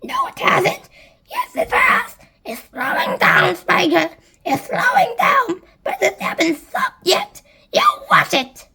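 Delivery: high, squawky back-and-forth; high-pitched quarrelling; bickering aunts. A narrator's reading. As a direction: argue excitedly in high character voices. Aunts argue over the peach.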